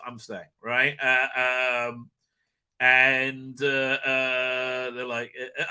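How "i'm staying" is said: soft, low determination; hushed and firm; quiet resolve. Retold or imitated. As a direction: murmur it like a vow. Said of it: "It's just".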